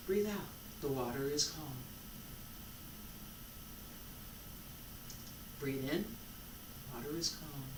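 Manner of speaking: guided meditation. Acted. Teacher guiding students through breaths, soft meditation voice. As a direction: lull them into calm breaths.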